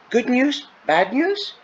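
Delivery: high, worried guesses at the news; anxious questioning. A narrator's reading. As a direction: ask nervously, pitched right up. Anxious questions after hearing of news.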